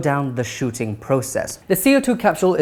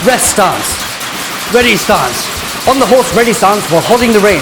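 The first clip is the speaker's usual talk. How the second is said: drill officer; 2 semitones higher, 8 dB louder than usual. Acted. Skit, reciting drill manual.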